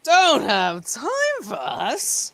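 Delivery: high, slow, drawn-out challenge; loud indignant echo. Acted. Thug answering a dismissal by the player, loudly indignant. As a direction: throw it back loudly, stretching each word.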